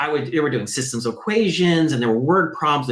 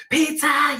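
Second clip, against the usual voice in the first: high squeal of Pizza; excited kids; 14 semitones higher than usual. Retold or imitated. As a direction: cheer it like kids racing down the hallway.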